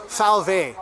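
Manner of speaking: "V W" said fow-vay; slow German "V W": fow, vay; German letter names. Retold or imitated. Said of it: "how do you say it in German?".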